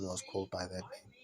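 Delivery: hushed, breathy whisper of the whole line. Shown as a demonstration. Lecturer whispering to students that no such person existed. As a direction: drop to a whisper to drive the point home.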